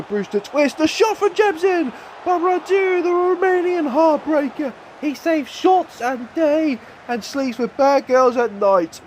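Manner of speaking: excited commentary. Real, not acted. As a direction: yell the save like a live commentator. Goalkeeper saves in cup final.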